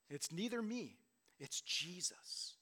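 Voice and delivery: ordinary talk, nothing marked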